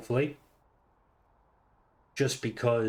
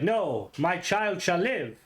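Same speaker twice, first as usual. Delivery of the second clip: drawn-out, higher god voice intervening; slow, hammed-up divine decree; 6 semitones higher, much slower than usual. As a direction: play a god proclaiming from above. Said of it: "you just hear a voice like".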